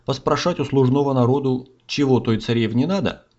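plain reading voice

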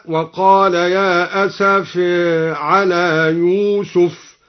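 slow chant, last vowel of "أسف" tilted toward e; taqlil. Shown as a demonstration. Reciter demonstrates al-Duri's reading, "أسف" with tilted final vowel. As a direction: draw out "أسف" as asafe. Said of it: "وقلل قوله تعالى".

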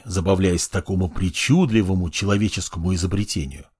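ordinary delivery

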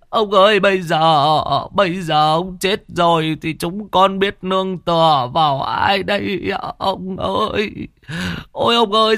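put-on old Xuyên wailing over the headless envoy; wailing lament. A narrator's reading. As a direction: wail and sob over the body, stretching each ông ơi. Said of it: "mếu máo khóc lóc".

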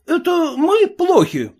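high, indignant exclamation; exclaiming. Acted. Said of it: "воскликнул сын".

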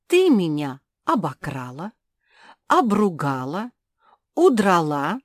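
loud, wide-swinging aggrieved tirade; indignant. A narrator's reading. Жози rails at the spitz.

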